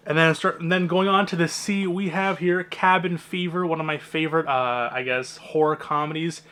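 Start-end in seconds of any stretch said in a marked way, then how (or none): none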